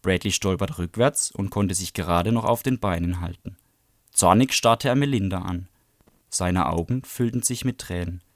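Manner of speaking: plain reading voice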